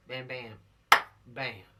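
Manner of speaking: ordinary talk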